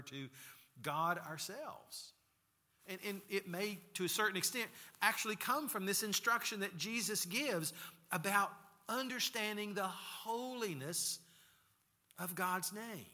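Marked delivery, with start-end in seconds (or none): none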